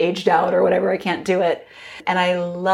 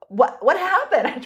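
surprised husband; 10 semitones higher than usual. Retold or imitated. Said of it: "He's like".

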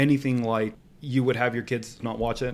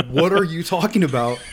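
louder, laughing outrage; laughing disbelief; 4 semitones higher, 6 dB louder than usual. Retold or imitated. Son laughing at parents banning the show, loud and incredulous.

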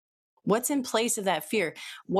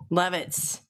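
normal talking voice

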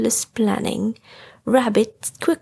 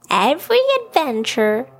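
lightly voiced higher character; a slightly raised character voice for a lesson; storybook character; 4 semitones higher, faster than usual. Story figure voiced beside narration.

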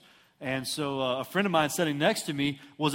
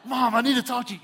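much higher, more forceful retold plea; insistent plea; 8 semitones higher, 4 dB louder than usual. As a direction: press mom urgently, imitating a desperate kid. Son insists after being shushed.

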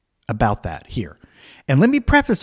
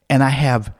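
ordinary talk, nothing marked

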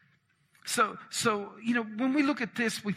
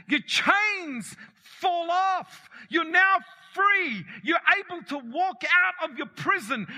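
preaching climax; 9 semitones higher than usual. Acted. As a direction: lift it up like chains breaking. Preaching climax about freedom.

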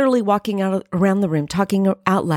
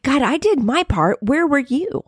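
indignant, higher-pitched protest; mad at God; 6 semitones higher than usual. Retold or imitated. A let-down mother demanding answers from God, indignant. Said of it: "I was just mad".

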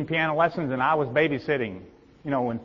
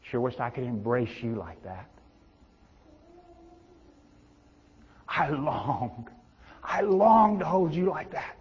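tender inviting voice. Retold or imitated.

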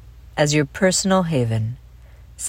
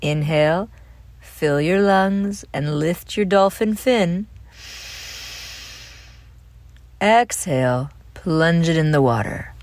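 guided breathing. Acted. Host leads children through dolphin breathing, calm guided meditation. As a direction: lead each breath calmly, leaving room to breathe.

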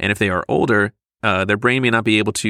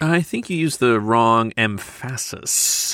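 slowly, "emphasis" stressed on its second syllable; misplaced stress; slower than usual. Acted. Co-host joking about stress, "emphasis" wrongly stressed on FASS. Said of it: "The old wrong emphasis on the wrong syllable".

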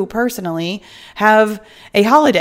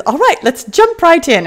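put-on a botched South African accent that drifts toward Mary Poppins British, higher and louder; bad South African accent; 9 semitones higher, 5 dB louder than usual. Host imitating another podcaster's catchphrase, mock Mary Poppins British accent. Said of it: "I'm really butchering her accent".

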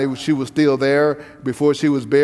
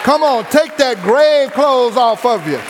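preaching at full force; 5 semitones higher than usual. Acted. Preacher to the congregation, rising fervent preaching. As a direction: preach it up to the peak.

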